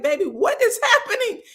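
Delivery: high, laughing question; laughing disbelief. Retold or imitated. Retelling the crying scene, asks through a high laugh. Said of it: "I was like".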